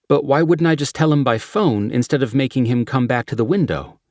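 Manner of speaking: chirpy ticket agent at the window; lightly voiced clerk. Clerk questioning the deputy.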